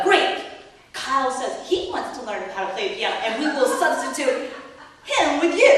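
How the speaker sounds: loud laughing threat to replace him; hammed-up mom shouting a threat; loud sarcastic ultimatum, half-laughing; mock angry threat. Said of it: "on the verge of yelling back at him".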